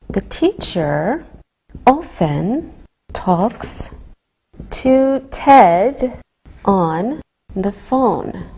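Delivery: slow, word by word; slow and careful. Shown as a demonstration. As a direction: chunk it slowly for a caller. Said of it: "speak slowly on the telephone".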